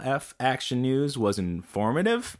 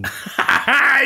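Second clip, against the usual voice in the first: high loud cackling laugh; loud burst of laughter; 11 semitones higher, 6 dB louder than usual. Real, not acted. Laughing at keister joke.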